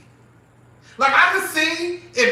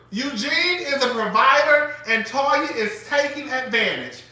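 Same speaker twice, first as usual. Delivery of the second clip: ordinary talk, nothing marked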